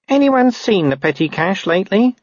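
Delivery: high-pitched, innocent question; high-voiced junior enquiring; tentative constable. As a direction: ask the room lightly. Narrator voices Constable Ping.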